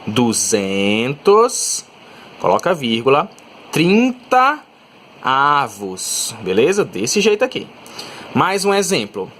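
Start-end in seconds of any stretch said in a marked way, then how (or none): 0.1-6.4 slow dictation. Shown as a demonstration. Teacher dictating duzentos trinta avos to the class. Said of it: "você vai falar assim, ó".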